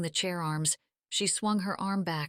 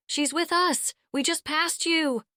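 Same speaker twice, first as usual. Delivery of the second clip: higher, louder yell into a phone; 11 semitones higher, 5 dB louder than usual. Acted. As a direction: yell it out so they hear. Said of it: "yelled out".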